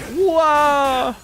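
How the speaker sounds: surprised whoa. Retold or imitated. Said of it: "I'd be like".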